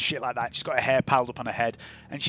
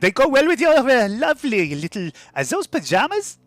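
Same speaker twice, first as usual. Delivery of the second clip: higher, louder put-on trader voice; butter up the customer while teasing his outfit; flattering saleswoman; 5 semitones higher, 6 dB louder than usual. GM voices a shoe seller.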